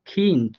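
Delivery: খিন্ড ending in a light t, not a full d; final d as light t. Shown as a demonstration. Teacher showing students how খিন্ড ends, light t. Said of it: "হালকা ট এর মতো করে উচ্চারণ করছি".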